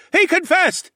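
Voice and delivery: high loud screaming chant; wild crowd scream. A narrator's reading. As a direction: scream it like a mob. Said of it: "the audience screamed wildly".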